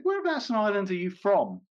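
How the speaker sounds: lightly voiced colleague, slyly asking where he's from; comedian's teasing question. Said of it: "he said".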